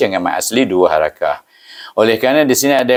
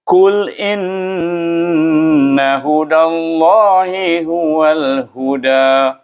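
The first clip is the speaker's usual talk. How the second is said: slow, higher, flowing melodic chant; flowing Quranic chant; fluent mengaji recitation; 6 semitones higher, much slower than usual. Shown as a demonstration. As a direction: recite Qul inna hudallahi huwal huda smoothly as in mengaji. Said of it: "baca dengan lancar sebagai orang baca mengaji".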